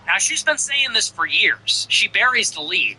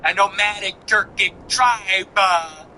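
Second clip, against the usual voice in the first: slow, drawn-out mock of a dramatic pause; mocking her delivery; 2 semitones higher, slower than usual. Host mocking the commentator's theatrical delivery, slow and drawn out. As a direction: hang on every word like she does. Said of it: "I can't get over the delivery".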